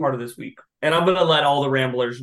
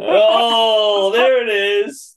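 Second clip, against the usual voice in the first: long, loud, high crow of triumph; loud gloating cheer; gleeful outburst; 6 semitones higher, 7 dB louder, much slower than usual. Real, not acted. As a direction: pounce on the slip, drawn out and high. Host teases co-host's slip of the name.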